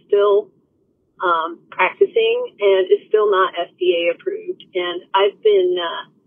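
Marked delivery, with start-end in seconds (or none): none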